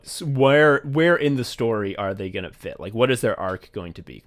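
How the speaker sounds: ordinary talk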